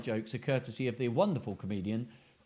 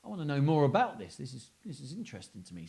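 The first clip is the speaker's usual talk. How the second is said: tipsy rambling thought; much faster than usual. Retold or imitated. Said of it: "He thinks".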